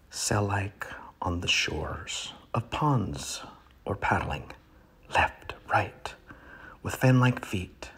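dramatic poetry reading with sudden swells; poem reading. Acted. Comic reader performing a poem to his audience, hammed up. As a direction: reads the duck poem with grand pauses.

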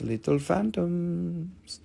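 put-on soft, slow baby-talk coo over the little phantoms. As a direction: coo at the tiny phantoms like they're babies. Crystal live sale, cooing at phantoms.

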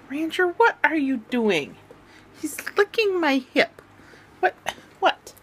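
dog talk. Real, not acted. Talking to her dog.

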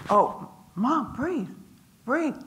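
high, urgent coaxing; retold urging. Son tells his crying mother to breathe, mock urging.

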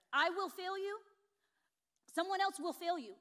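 ringing pulpit emphasis; emphatic preaching. Real, not acted. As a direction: preach it out with full force.

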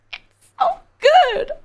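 high, cracking cry of delight; overwhelmed squeal. Real, not acted. Streamer overcome by the song, squealing high with delight. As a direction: squeal like it almost hurts.

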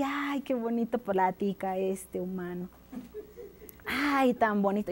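soft dreamy murmur; enchanted sigh. Acted. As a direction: gush softly, charmed by the story. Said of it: "el ogro se le quedaba mirando así". (suspiro embelesado)

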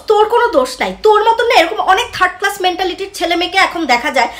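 ordinary talk, nothing marked

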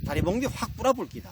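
mock threat in Gyeongsang dialect; annoyed Gyeongsang husband. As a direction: warn your bride with punchy Gyeongsang intonation, mildly cross. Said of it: "경상도 신랑이 화가 조금 났습니다". (조금 화난 경상도 사투리)

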